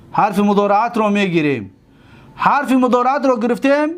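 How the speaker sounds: ordinary talk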